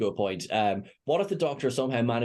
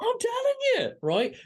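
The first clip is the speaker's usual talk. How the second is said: excited pitch; 8 semitones higher than usual. Real, not acted. Pitching themselves as writers.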